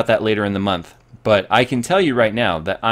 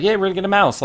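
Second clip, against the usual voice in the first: put-on happy kid voice; 9 semitones higher, faster than usual. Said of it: "I was all happy".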